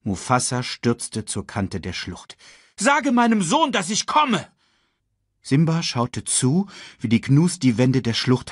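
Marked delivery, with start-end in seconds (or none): 2.8-4.5 higher, louder urgent command; urgent call. A narrator's reading. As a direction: call it out, racing to his son. King calls out urgently. (König ruft dringlich, lauter)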